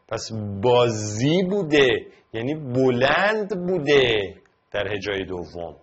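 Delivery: normal talking voice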